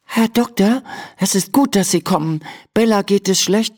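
put-on a shy girl introduces herself; Emma, girl voice. A narrator's reading. Emma tells the doctor her name, shy.

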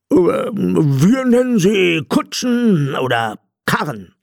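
high, slow, hesitant character voice; drawn-out, high robber voice; blind robber. A narrator's reading. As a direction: explain it haltingly in a high put-on voice. Robber answers the captain.

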